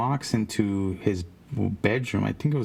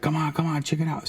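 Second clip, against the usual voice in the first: higher-pitched mock urging; retold coaxing; 7 semitones higher, faster than usual. Man urging a reluctant kid into the room, coaxing. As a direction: imitate an eager pest egging someone on.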